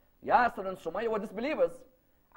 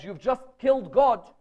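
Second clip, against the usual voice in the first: loud, higher, horrified reproach; outraged accusation; 4 semitones higher, 6 dB louder than usual. Retold or imitated. Mother to son after the idol breaks, outraged accusation. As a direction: accuse them loudly of something unthinkable.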